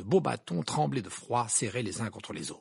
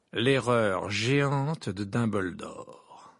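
slow, weighty headline read; newspaper headline; 2 semitones lower, 4 dB louder, much slower than usual. A narrator's reading. Reading out a scandalous newspaper headline, slow and weighty. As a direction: spell out the headline very slowly.

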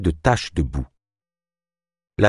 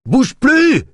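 very high, loud yell; yelled order; 6 dB louder than usual. A narrator's reading. Second soldier yelling at the Orsite boy, loud and high. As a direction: scream it at the intruder. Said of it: "hurla l'autre soldat".

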